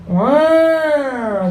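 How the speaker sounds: long wailing siren noise; mock siren wail; imitated police siren.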